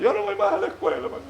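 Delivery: mock whiny little boy, voice up high; a hint of a sobbing schoolboy; crying child. Boy tells mother he won't go to school, mock whine. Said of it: "el niño vino llorando de la escuela".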